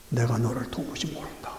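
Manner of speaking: low, soft, deliberate pronouncement; quiet voice of the Lord. Acted. Preacher quoting the Lord's rejection, low and hushed.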